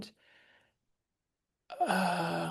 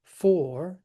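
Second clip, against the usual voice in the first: FOR hit louder; stress on FOR; 3 semitones higher, 7 dB louder than usual. Shown as a demonstration. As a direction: lean hard on the word "for". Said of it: "I would trochee that to for".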